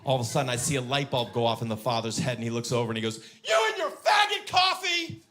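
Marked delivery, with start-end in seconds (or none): 3.4-5.2 high, drawn-out furious shout; dad's angry yell. Acted. Angry dad to the other driver, furious yelling. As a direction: hurl it slowly and loudly as an insult. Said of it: "Dad's yelling at me".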